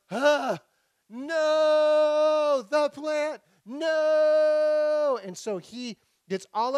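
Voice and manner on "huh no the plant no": high, loud, drawn-out mock lament; gasp, then wail it in overblown despair; comic cry of horror, stretched long; hammed-up anguished wail. Jonah's horror at the dead plant.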